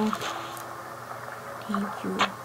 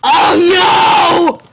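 dramatic wail; 7 semitones higher, 8 dB louder than usual. Pretend. Dramatic reaction to game scene.

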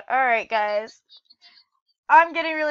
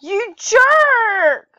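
higher, loud insult; angry yell; 6 semitones higher, 8 dB louder than usual. Real, not acted. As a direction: hurl "You jerk!" at him. Friend attacks him again.

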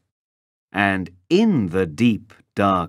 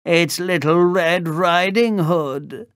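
put-on growly wolf faking a higher girlish voice; wolf posing as girl; 9 semitones higher than usual. A narrator's reading. Wolf at the door pretends to be the girl, raised growl. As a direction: pitch it up in a badly faked girl's voice. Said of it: "growled the wolf".

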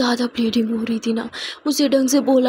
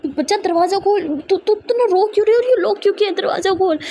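higher, fast urgent shouting through a door; shouting brother; 6 semitones higher than usual. A narrator's reading. Brother outside the locked room shouts at his sister, urgent. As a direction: bang on the door and yell for her to open. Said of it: "जो चिल्लाता हुआ कहता है".

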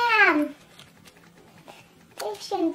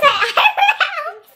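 higher, laughing at her own mess; 10 semitones higher, 8 dB louder than usual. Real, not acted. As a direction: giggle through it, embarrassed. Laughs at finished eyebrows.

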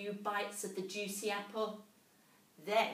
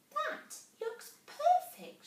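very high, slow delighted squeak; a mouse thrilled at the tiny hole; 6 dB louder, slower than usual. A narrator's reading. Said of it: "she squeaked".

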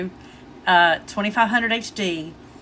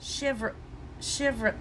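very soft, fading realization; trailing realization; 13 dB quieter, slower than usual. Retold or imitated. Husband realizes his mistake, softly trailing off. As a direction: let it trail off as it dawns. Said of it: "And then he said".

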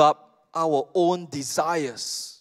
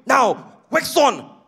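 Mister Miyagi; 5 semitones higher, much slower than usual. Retold or imitated. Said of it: "Mister Miyagi keeps on going".

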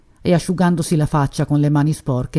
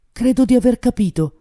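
higher, a hint of breathless hope; breathless hopeful exclamation; 6 semitones higher than usual. A narrator's reading. Suspect suddenly realises who the killer is, breathless with hope. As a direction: blurt it out as hope catches your breath. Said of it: "esclamò mentre la speranza gli toglieva il respiro".

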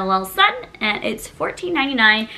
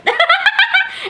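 a long high laugh; 4 dB louder than usual. Real, not acted. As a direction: laugh at her own cheeky line. Laughing at own joke.

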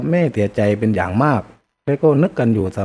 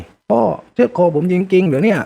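stunned disbelief; 8 semitones higher than usual. Acted. Son just cut by father.

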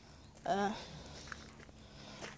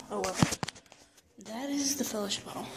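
usual speaking voice

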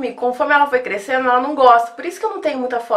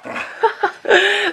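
high laugh; 9 semitones higher than usual. Real, not acted. Laughs at barking dog.

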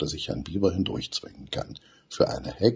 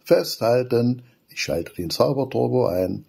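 louder, higher playful call; cheeky excitement; 6 semitones higher, 4 dB louder than usual. A narrator's reading. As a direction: hold on, here we go. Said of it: "lacht Aranka übermütig". (übermütig, lauter Ruf)

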